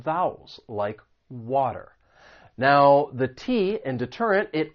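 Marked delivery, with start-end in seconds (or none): 1.3-1.9 American water, said "wah-der"; flap T. Shown as a demonstration. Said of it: "this occurs when the T comes between".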